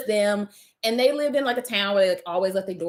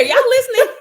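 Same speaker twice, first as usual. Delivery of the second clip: laughing; 14 semitones higher, 10 dB louder than usual. Real, not acted. Laughing buildup before the punchline.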